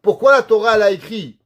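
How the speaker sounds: ordinary delivery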